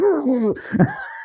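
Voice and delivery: high muffled mock struggle for air; imitated smothered noises. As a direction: act out trying to breathe under a covering hand. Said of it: "But you're like".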